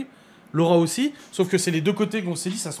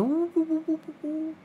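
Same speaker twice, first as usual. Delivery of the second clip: high cooing ouh ouh; talking to a doggy; 14 semitones higher, slower than usual. Retold or imitated. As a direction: coo like talking to a puppy. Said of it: "tu me parles pas comme un toutou". (voix pour parler à un toutou)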